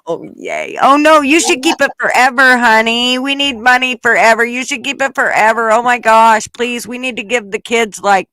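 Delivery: high, loud mock-cheerful gushing; joy turning sour. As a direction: fake delight, dripping with sarcasm. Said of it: "I go".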